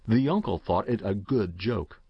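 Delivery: usual speaking voice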